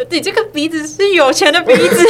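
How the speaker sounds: high, loud, laughing mom voice; imitating mom. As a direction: play mom cheerfully, through a laugh. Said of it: "她就说".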